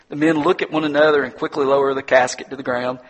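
ordinary talk, nothing marked

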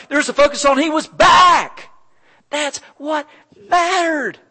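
shouted high and loud, full force; preaching shout. Real, not acted. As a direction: cry it out to the whole church. Preacher building to climax.

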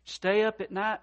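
usual speaking voice

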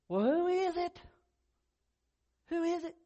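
a prophet out of options, half-hearted exasperation; exasperated Samuel. Retold or imitated. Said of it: "I can imagine Samuel saying".

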